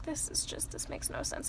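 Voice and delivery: confused whisper; whispered to herself. Retold or imitated. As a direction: breathe it out, bewildered. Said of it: "and I'm like".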